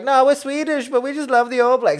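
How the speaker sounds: high, loud imitated fans; gushing fan. Swedish fans explaining themselves to a reporter, gushing fan. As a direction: protest it in a high, excited pitch.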